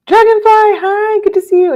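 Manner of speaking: high, loud sing-out hello; high-pitched greeting. Real, not acted. Streamer greeting a viewer who just arrived, high sing-out greeting.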